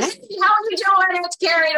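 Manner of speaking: high, bright phone hello; bubbly over-the-top greeting. Shown as a demonstration. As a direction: chirp the hello way up like a cheerleader. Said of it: "If I have someone who talks like this, I'm not going".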